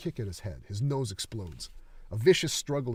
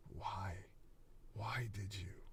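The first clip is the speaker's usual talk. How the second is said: whispered, much softer; broken whisper; 12 dB quieter, slower than usual. Acted. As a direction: plead it out hurt. Ren confronting coach, broken.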